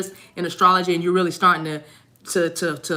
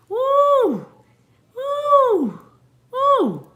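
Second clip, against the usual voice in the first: very high joyful woo cries; excited whoops; much slower than usual. Real, not acted. Reader whoops happily at song's message, high cheer. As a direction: whoop with delight at the sign.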